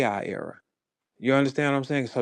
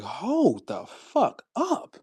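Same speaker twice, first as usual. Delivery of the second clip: very drawn-out, higher-pitched astonishment; stunned disbelief; 6 semitones higher, much slower than usual. Retold or imitated. Comic reacting to blinging diamonds, slow stunned disbelief. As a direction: stretch every word out in mock amazement.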